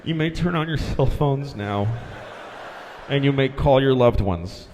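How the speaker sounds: breathless shaken pilot announcement; out of breath. Retold or imitated. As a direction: announce it still panting. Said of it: "he's totally out of breath".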